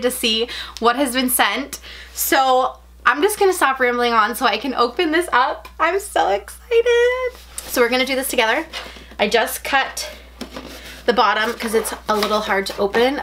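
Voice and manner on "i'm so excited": high, slow, drawn-out squeal of excitement. Real, not acted. Unboxing her Disney box, she squeals with excitement. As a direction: stretch it out high like you can't contain yourself. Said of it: "Sorry if I sound so dramatic".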